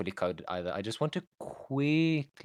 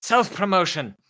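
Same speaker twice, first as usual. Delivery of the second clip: high, loud cheer; excited cheer; 10 semitones higher, 8 dB louder than usual. Real, not acted. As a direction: cheer it out gleefully. Cheering the plug.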